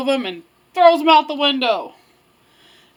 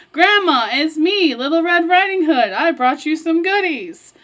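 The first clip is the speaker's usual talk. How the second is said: put-on higher, singsong little-girl voice; Red calling out; 4 semitones higher than usual. Little Red announcing herself at Grandma's door, calling out. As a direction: call brightly through the door as Little Red.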